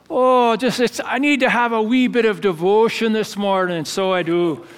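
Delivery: Scottish brogue. Retold or imitated. Head dishwasher retells young Scottish teammate dodging dishes, imitated Edinburgh brogue. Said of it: "that thick, you know, Edinburgh brogue".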